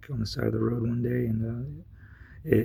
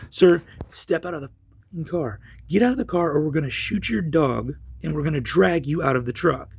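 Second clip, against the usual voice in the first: retold officer's threat, pitched up; threatening order; 4 semitones higher than usual. Officer ordering man out of truck, threatening. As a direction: issue a forceful ultimatum as the officer. Acted police threat in quarrel.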